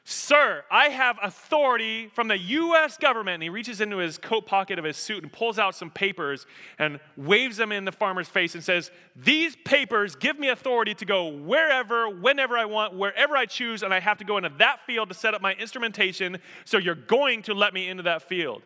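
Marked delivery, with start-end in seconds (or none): 0.0-3.4 loud, higher, indignant insistence; irate official. Acted. Government man to the farmer who refuses him, irate. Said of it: "he's getting a little irate. He's getting perturbed". 9.1-18.6 raised, higher, insistent tirade; irate official. Acted. Government man waving papers at the farmer, irate. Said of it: "he's getting a little irate".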